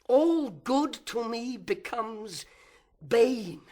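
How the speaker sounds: bitter outburst. Acted. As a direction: build slowly, then hurl "bane" out loud. Villain's outburst in soliloquy.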